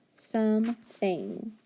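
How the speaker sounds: slow "something", split into SOME and THING; two word parts. Shown as a demonstration. Teacher joining two parts for pupils, slow and segmented. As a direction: say "something" slowly in two even chunks. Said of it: "some and thing put together".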